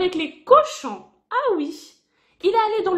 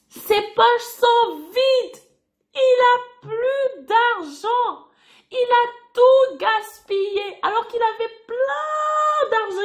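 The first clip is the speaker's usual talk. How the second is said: drawn out and heavy, each word weighed; dramatic slow storytelling; slow emphatic telling; 4 semitones higher, slower than usual. Acted. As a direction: spell out the loss slowly for the kids. Teacher stressing the loss to children.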